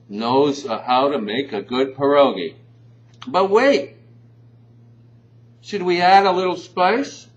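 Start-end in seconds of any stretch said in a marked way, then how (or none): 3.3-7.3 loud, high infomercial pitch; infomercial hype. Acted. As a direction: sell the twist like a TV ad. Infomercial twist, louder.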